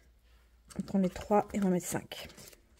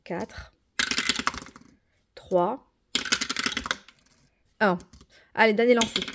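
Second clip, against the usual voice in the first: ordinary talk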